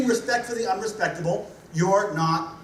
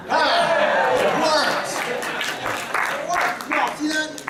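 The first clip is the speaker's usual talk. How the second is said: mock amazement; 7 semitones higher, much slower than usual. Mock answered prayer, feigned amazement.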